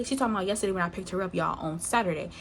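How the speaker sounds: ordinary talk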